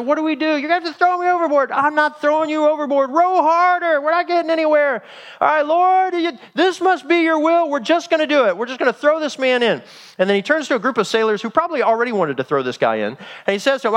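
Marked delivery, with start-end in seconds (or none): 0.0-9.8 high, frantic shouting match in a storm; screaming in panic. Acted. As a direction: yell desperately over the chaos. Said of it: "They're screaming at each other".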